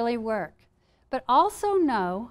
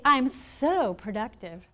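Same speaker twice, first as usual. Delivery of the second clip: slow, drawn-out playful boast; a little sass and humor, stretching the words; sassy; slower than usual. Acted. Speaker models the turnaround mantra to the audience, slow and sassy. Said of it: "you know, sassy, little humor".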